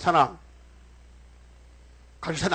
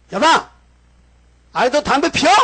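loud, high, scolding yell down the phone; 전화로 호통치듯 크게; scolding shout; 8 semitones higher, 9 dB louder than usual. Retold or imitated. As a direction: bellow at the young man like an angry pastor. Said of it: "소리를 꽉 지르게요".

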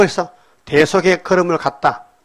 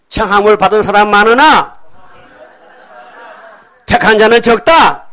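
loud, higher chanted scripture line; preaching recitation; 5 semitones higher, 8 dB louder than usual. Real, not acted. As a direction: proclaim it to the congregation. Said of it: "따라합시다".

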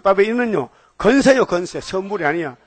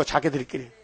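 quiet echo; 6 semitones lower, 9 dB quieter than usual. Acted. Quiet echo of repeated phrase.

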